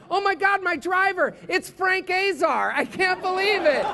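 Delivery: high, hammed-up astonishment; amazed passenger. Acted passenger amazed at driver.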